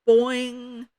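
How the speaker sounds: high, ringing springy call; muffled yell. A narrator's reading. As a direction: yell it high as if through a mask. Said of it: "yelled a muffled".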